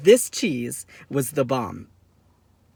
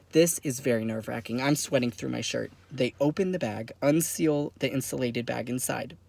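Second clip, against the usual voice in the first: ordinary talk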